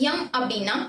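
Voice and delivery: normal talking voice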